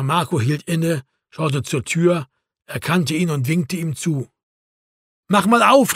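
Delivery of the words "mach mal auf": higher-pitched call through the door. A narrator's reading. Erwin calling to Marco inside the locked pub, calling out. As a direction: call to be let in. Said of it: "rief Erwin".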